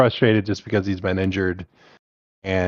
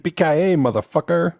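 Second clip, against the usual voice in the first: put-on German accent; 8 semitones higher than usual. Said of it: "Say the last part again".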